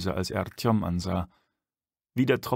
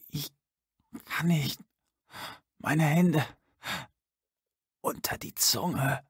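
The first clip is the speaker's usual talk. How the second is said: put-on groaning old man; 5 semitones higher, slower than usual. A narrator's reading. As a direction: groan it out, too weak to move. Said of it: "stöhnte".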